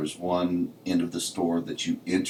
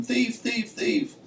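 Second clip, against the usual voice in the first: imitated screaming; much slower than usual. People screaming as the thief flees the store, imitated high scream. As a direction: mimic a crowd's alarm cry in a high voice. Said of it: "people start screaming".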